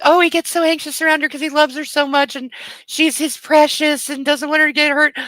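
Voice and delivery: high-pitched gushing mockery; mocking the author. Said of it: "the author's intention is like".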